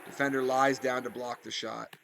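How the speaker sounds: normal talking voice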